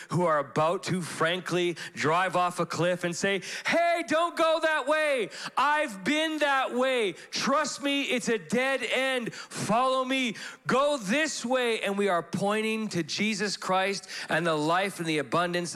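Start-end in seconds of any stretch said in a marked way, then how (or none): 3.7-11.8 urgent calling out. Retold or imitated. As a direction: call them back from the edge. Church calling lost people back.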